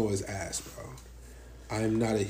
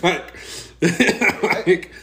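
laughing loudly, high-pitched; words broken by laughter; 7 semitones higher, 7 dB louder, much slower than usual. Real, not acted. Laughing after joking threat.

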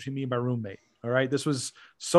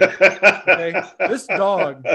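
louder talk broken by laughter; laughing; 3 semitones higher, 6 dB louder, much slower than usual. Real, not acted. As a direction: laugh through the setup. Laughing while telling.

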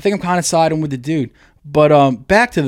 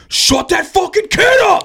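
much higher, slow furious shout; angry shout; slower than usual. Retold or imitated. Fed-up moviegoer shouting about the kid, furious. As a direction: yell across the theater in rage. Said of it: "the guy again in the back just goes".

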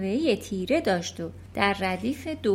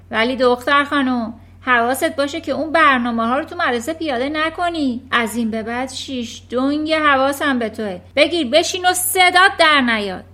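louder, higher menacing orders; threatening; 4 semitones higher, 10 dB louder than usual. Acted. Headmistress threatening Matilda, loud and menacing. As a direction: warn her she's being watched.

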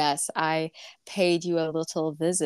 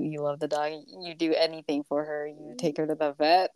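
ordinary talk, nothing marked

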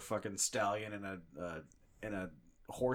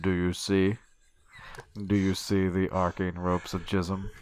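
serial killer voice; 2 semitones lower, 4 dB louder than usual. Acted. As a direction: savor it like a psychopath. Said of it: "I will not do that voice again".

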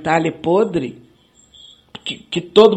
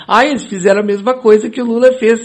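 fast, higher, sneering mimicry; mock the reporters' smug tone; mocking the press; 5 semitones higher, 5 dB louder, faster than usual. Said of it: "essa imprensa podre que nós temos aí dizendo".

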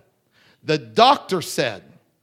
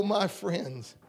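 ordinary talk

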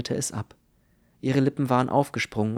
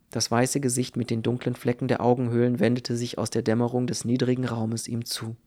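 usual speaking voice

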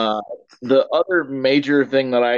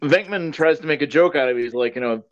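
ordinary delivery

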